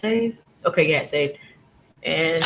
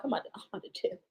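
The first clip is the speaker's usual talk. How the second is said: fast, much softer muttering; muttered exit; 13 dB quieter, much faster than usual. Retold or imitated. Fast under-breath mutter to herself about leaving. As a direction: mumble it under your breath, quick. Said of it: "I'm like".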